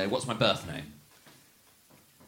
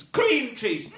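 "Cream" shouted high, "cheese" dropped; mock stress on cream; 7 semitones higher than usual. Shown as a demonstration. Mocking American stress, "Cream" shouted. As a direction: yell "Cream", let "cheese" fall. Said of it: "they do it with all sorts of things".

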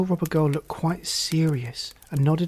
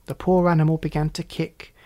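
plain reading voice

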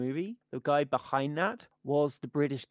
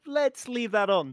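hasty backpedal; 8 semitones higher, 4 dB louder than usual. Retold or imitated. Chris Tucker quick reversal quoted.

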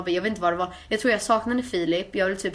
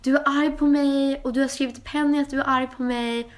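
mock accusation from the friend, a bit higher; accusing friend; 5 semitones higher than usual. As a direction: play the hurt friend accusing her. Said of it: "hon var så här". (härmar den sårade väninnan)

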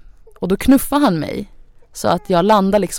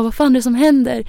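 alarmed; 7 semitones higher than usual. Retold or imitated. Her best friend Liban, alarmed at her breaking down, startled. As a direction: ask in sudden alarm.

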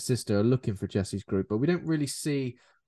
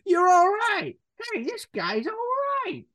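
mock vampire gang voice; 6 semitones higher than usual. Vampire gang to Jesse, grudging high praise. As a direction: squeak out a grudging compliment.

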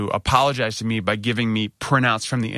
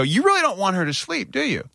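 high, sarcastic question; mock-sweet sarcasm at the noisy nurse; sarcastic jab; 10 semitones higher than usual. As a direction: needle her with sarcasm.

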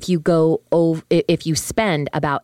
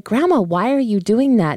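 lightly voiced child, pitched higher; higher, lilting retold child's question; little girl asking; 7 semitones higher, 3 dB louder than usual. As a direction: mildly imitate a puzzled kid questioning grandma. Retells herself as little girl.

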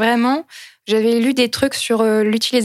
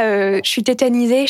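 usual speaking voice

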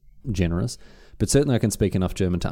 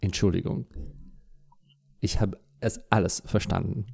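spoken in German; 6 dB quieter than usual. Pretend. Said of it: "turn around and say".

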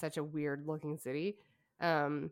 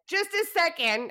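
call out; 12 semitones higher, 7 dB louder than usual. Retold or imitated. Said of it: "and you're going, like".

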